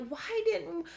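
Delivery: high-pitched, exasperated string of questions; angry questioning. Retold or imitated. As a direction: plead for answers in frustration. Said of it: "I get so angry because I'm like".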